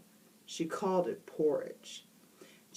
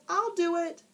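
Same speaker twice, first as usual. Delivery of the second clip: a lightly voiced eager boy, higher; called out; 12 semitones higher than usual. A narrator's reading. Boy volunteering to his teacher, eager call. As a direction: call it out keenly, high. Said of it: "Jason called".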